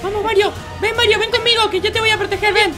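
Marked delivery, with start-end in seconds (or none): none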